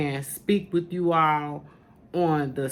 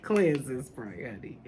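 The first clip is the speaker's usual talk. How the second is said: laughing aside; 3 semitones lower, 7 dB quieter, slower than usual. Real, not acted. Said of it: "this is my".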